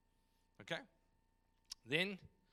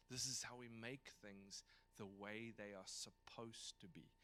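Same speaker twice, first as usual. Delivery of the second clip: very soft, low voice; hushed, low acted teacher; gentle teaching; 6 semitones lower, 17 dB quieter than usual. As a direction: teach them quietly, almost in a whisper. Voicing Jesus gently teaching his followers.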